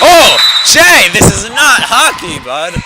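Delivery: startled, amused-sounding commentator outburst; shocked exclamation. Real, not acted. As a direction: cry out, then slowly scold the player. Reacting to a rough foul.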